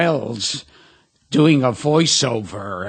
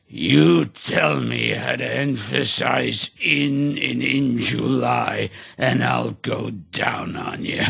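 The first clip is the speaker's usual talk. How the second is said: Orson Welles; 4 semitones lower than usual. Retold or imitated. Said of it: "And he goes".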